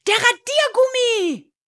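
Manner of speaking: put-on very high, loud child's shout; excited answer. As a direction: blurt out the answer at full pitch. Boy calls out riddle answer. (aufgeregt, hoch herausgerufen)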